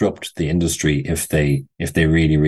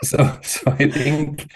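laughing; 10 semitones higher, much slower than usual. Real, not acted. Laugh before answering.

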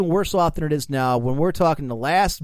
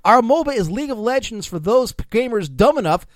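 raised, punchy satirical reading; mock corporate speech; 6 semitones higher, 4 dB louder than usual. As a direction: read it like a smug executive. Reads fake CEO quote aloud.

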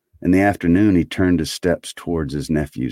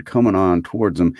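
ordinary delivery

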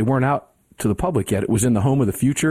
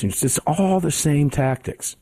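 ordinary talk

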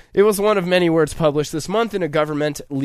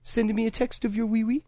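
a lightly put-on French accent, higher; a playful fake French accent pitched up; mock French accent; 8 semitones higher than usual. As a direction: tease it like a smug Frenchman. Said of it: "weird French accent".

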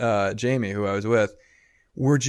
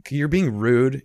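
mock rude complaint; 4 semitones higher, 6 dB louder, slower than usual. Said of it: "I wasn't like".

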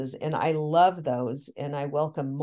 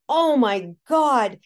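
high, loud and slow, overwhelmed with awe; awed exclamation; 7 semitones higher, 7 dB louder, much slower than usual. Retold or imitated. Telling her host about the spirit husband, awed and slow. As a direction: let the wonder pour out, drawn out.

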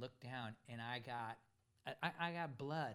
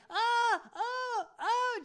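shouted, much higher and louder alarmed cries; alarmed owner; 12 dB louder, much slower than usual. Retold or imitated. Narrator imitates the owner panicking as dogs attack, loud alarmed shout. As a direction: cry out like a panicked owner pulling her dog. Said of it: "she's like".

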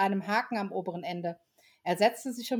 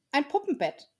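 higher small voice; a light small child; little child; 7 semitones higher than usual. Acted. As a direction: answer like a shy little kid. Child asks for doll bed.

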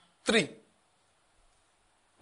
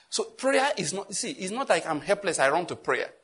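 ordinary talk, nothing marked

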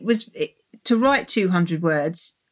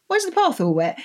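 puzzled character; 9 semitones higher, faster than usual. Retold or imitated. Character voiced in story.

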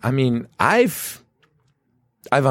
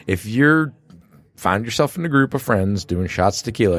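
ordinary talk

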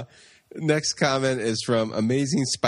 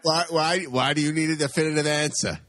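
lightly voiced defensive jock; a hint of a guy who feels cornered; Flash; 3 semitones higher than usual. Flash pushing back at the co-host's question, lightly voiced. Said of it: "Flash, get over here".

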